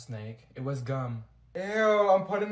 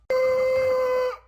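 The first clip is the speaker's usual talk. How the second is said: very high loud gasp; sharp high gasp; 6 dB louder than usual. Acted. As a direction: gasp sharply as the pain breaks. Pain suddenly stops.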